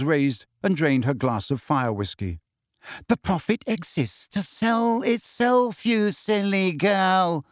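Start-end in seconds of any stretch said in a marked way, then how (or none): 3.1-7.4 put-on slow, higher, icy put-down of a foolish girl; cold disdain. A narrator's reading. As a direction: talk down to her, drawn out and chilly. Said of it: "she said coldly".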